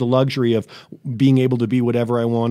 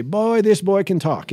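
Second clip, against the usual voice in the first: slow, high Southern grandmother voice; Southern accent; 7 semitones higher, slower than usual. Retold or imitated. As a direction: drawl it like a Southern granny. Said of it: "in her Southern accent".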